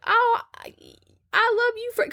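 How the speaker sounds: very high put-on girl's voice; hammed-up high feminine love line; mock lovestruck girl. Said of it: "And she's all like".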